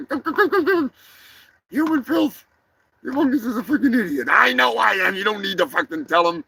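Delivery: high squeaky voice, fast and frantic; a little sidekick panicking and arguing; panicky high character. Acted. Sidekick arguing about aborting the mission, frantic high squeak.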